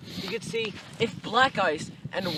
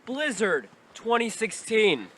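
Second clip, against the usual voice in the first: louder, hammed-up reporter sign-off; mock news reporter; 2 semitones lower, 7 dB louder than usual. Playing reporter signing off to the anchor, loud mock newscast. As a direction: hand it back to the studio like a TV anchor.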